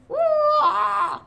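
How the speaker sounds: high wail; mock wail. Hurt wolf losing the fight, a high wail.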